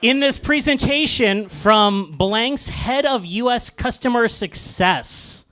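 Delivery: computer voice. Acted. Host reads the webinar email, slow mock computer voice. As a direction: spell out the job title in slow, stilted machine style. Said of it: "in a computer voice".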